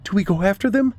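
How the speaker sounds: high, worried asking; anxious question. A narrator's reading. Driver asks about chasing the lost dogs, anxious. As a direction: ask high and uncertain.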